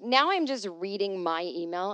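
ordinary talk

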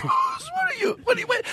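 high hammed-up old man yelling; mock outraged vet. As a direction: squawk it like a furious old vet. Imitating the vet's outrage.

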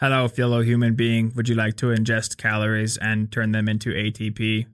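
low, flat android pretending to be human; a robot trying to pass as a person; robot faking human.

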